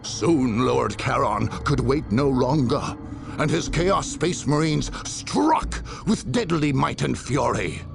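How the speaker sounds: normal talking voice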